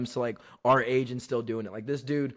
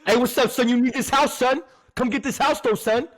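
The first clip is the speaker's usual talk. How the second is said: high, loud mock New York hustler; hammed-up New York accent, pitched up; 9 semitones higher, 4 dB louder than usual. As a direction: push the sale like a New York street hustler. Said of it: "like, a New York accent".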